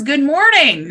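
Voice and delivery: high, loud singsong hello; cheery greeting. Acted. Rabbit greets the silent tar baby, cheery greeting. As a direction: call out a hearty greeting.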